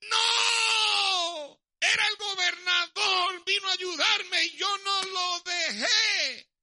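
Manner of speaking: very high, very loud wailing shout, slowing at the end; sobbing, shouted cry of a man who refused help; anguished crying scream. Acted. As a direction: scream it out in despair and regret. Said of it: "comenzó a gritar".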